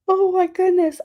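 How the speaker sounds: high, loud "oh my goodness"; exasperated. Real, not acted. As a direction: throw up your hands at yourself. Exasperated after repeated yawning.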